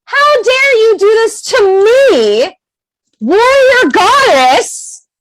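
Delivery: high, slow, drawn-out outrage; indignant anger. Acted. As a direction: stretch each word in righteous indignation. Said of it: "I was mad".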